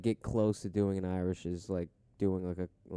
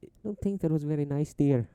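put-on higher-pitched, proper old-lady character voice; mock prim nanny, pitched up; Mrs. Doubtfire; 6 semitones higher than usual. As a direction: gently scold as a put-on matronly nanny. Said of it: "It sounds like Miss Doubtfire".

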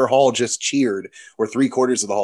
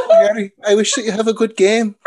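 loud, high, hammed-up Scottish accent; exaggerated Scottish lilt, pitched way up; over-the-top Scottish accent; 10 semitones higher, 5 dB louder than usual. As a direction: ham up a squeaky Scottish accent. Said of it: "has a very over-the-top Scottish".

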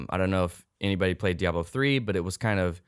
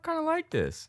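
squeaky grudging admission; high mock voice; 3 dB quieter than usual. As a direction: pitch way up, sheepishly pleased. Grudging surprise quote, voiced high.

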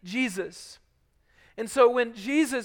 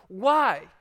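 usual speaking voice

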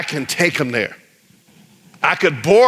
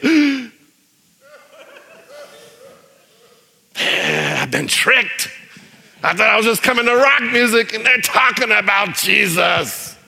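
high outburst of feeling tricked; hammed-up outraged realization; acted indignation; 6 semitones higher than usual. Angry concertgoer realizing the songs are religious, acted indignation. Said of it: "you can see him angry".

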